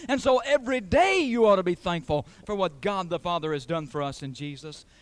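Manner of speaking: loud, high, driving sermon climax; full-force preaching. Real, not acted. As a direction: hammer the truth home to the congregation.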